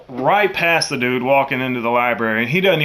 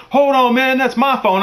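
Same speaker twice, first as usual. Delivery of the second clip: much higher, startled protest; a man caught out, pushing back; high indignant objection; protesting thief; 9 semitones higher than usual. Retold or imitated. Thief protests at being accosted.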